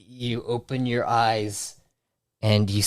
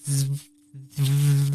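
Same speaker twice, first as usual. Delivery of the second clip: higher, buzzing zzz like static; electric buzz; 5 semitones higher than usual. Acted. GM voices the electric frequency in his ear, buzzing. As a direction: hum it like a crackling wire. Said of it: "you hear a slight".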